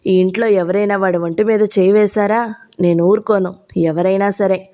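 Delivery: usual speaking voice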